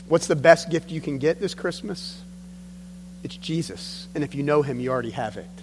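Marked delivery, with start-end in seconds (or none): none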